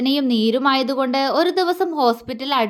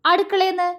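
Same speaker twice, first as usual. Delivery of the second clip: breezy, higher-pitched quick answer; nonchalant; 7 semitones higher than usual. A narrator's reading. Vinod owning up to Malu without remorse, nonchalant. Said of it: "അവൻ കൂസലില്ലാതെ പറഞ്ഞു".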